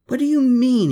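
loud high bellow; bellow, forcing indignation. A narrator's reading. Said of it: "he bellowed".

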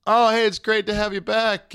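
high-pitched put-on welcome; mock cheery greeting. Dad imagining daughter's return from camp, mock cheery.

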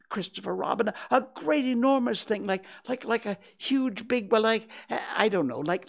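high, breathless little-pig voice; frightened stammer. A narrator's reading. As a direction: stammer excitedly, building to a loud finish. Frightened Piglet describes the monster.

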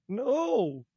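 high yelled no; a kid shouting no at the screen; mock cry of no. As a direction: childhood wail of protest, replayed. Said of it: "just going".

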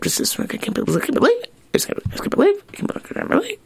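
high mock grumble, fading to a mutter; grumbling lady. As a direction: mimic a grumbling woman, mostly under her breath.

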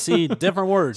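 loud, high, amused burst; laughing, pitched well up. Real, not acted. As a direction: say it through a laugh. Amused at the translation mix-up.

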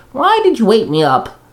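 high-pitched groggy whine; moaning complaint. A narrator's reading. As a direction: moan it high, like someone just woken. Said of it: "Komori moaned".

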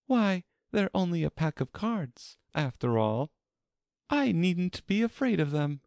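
high-pitched self-reassurance; Alice's high character voice, thinking aloud; Alice to herself. A narrator's reading. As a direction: reason it out privately in a high girl's voice. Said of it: "she added to herself".

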